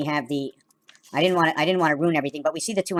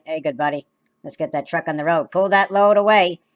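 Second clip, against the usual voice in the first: hammed-up trucker voice; trucker call; 9 dB louder than usual.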